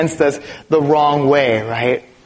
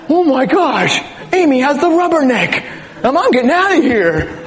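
mock panicked self; 9 semitones higher than usual. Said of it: "And I'm like".

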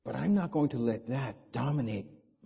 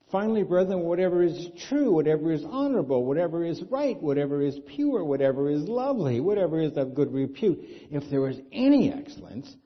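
loud, raised, rhythmic reading; scripture reading; 4 semitones higher, 5 dB louder than usual. Acted. Pastor proclaiming the verse to his congregation, loud and rhythmic. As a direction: proclaim each whatever to the hall.

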